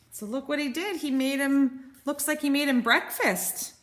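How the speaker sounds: ordinary delivery, nothing marked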